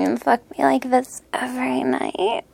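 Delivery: whispering. Acted. Whispered doubt.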